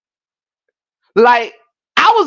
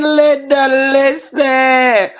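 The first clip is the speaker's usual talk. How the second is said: high, sustained, stretched words; long wailed mock exasperation; drawn-out comic plea; 4 semitones higher, much slower than usual. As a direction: hold each word on one high note. Comic catchphrase after a laughing fit.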